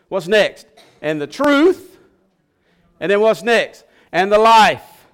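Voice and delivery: rising call to the pews; call and response. Real, not acted. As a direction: rouse the congregation to answer.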